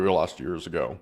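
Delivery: normal talking voice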